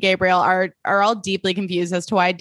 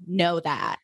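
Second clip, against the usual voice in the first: quoting Alison Dubois; 3 semitones lower, 5 dB quieter than usual. Retold or imitated. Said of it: "In the words of Alison Dubois".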